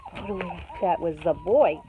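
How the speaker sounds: usual speaking voice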